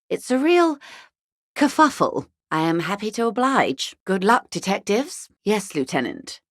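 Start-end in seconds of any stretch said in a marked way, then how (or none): 0.1-2.3 pitched way up, mock-exasperated; wry exasperation. To the detective about wars, wry high mock exasperation. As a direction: toss off the word like a fond joke.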